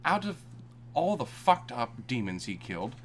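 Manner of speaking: ordinary talk, nothing marked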